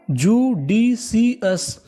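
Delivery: Judicious stretched out very slowly, part by part; syllable by syllable. Shown as a demonstration. Teacher assembling judicious for students, slowly in separate syllables. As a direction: sound out judicious as ju, di, si, us with gaps. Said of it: "तो meaning क्या बनी".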